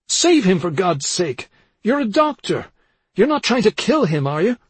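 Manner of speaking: high, loud, panicked begging; desperate plea. A narrator's reading. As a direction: beg urgently for a brother's life. Desperate plea to doctor for brother.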